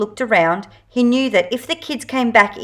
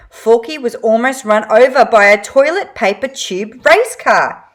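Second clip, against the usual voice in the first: plain reading voice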